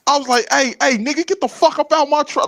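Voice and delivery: high, loud, worked-up shouting at a stranger; mock angry yell. Trucker yelling at a drunk climbing into his cab, high angry shout. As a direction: bark him out of the truck.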